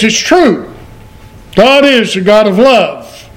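usual speaking voice

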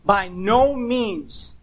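"By no means" very slow, "no" held long; slow, forceful "By no means"; emphatic NO MEANS. Shown as a demonstration. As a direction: draw out "no means" with full weight. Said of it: "more literally, you know what it's saying".

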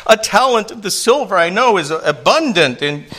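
high, pleading, hammed-up begging; mock pleading king's subject. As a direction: beg the king for your life, pitched high. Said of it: "The implication is".

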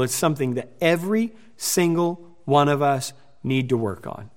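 usual speaking voice

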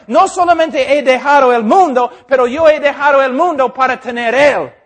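loud, high, fervent preaching; impassioned preaching. Acted. Preacher urging his congregation, loud fervent preaching. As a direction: preach it full force to the church.

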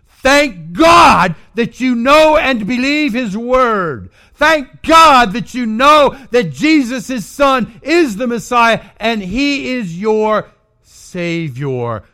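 loud, high preached exhortation; shouted sermon climax; preaching at full force. Acted. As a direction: thunder it from the pulpit.

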